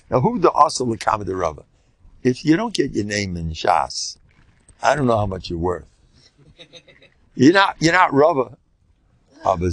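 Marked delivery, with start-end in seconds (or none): none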